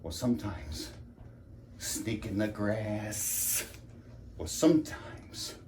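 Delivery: slow and soft; subdued brooding. Acted. Husband listing wife's insults, subdued brooding. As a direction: draw the words out under your breath.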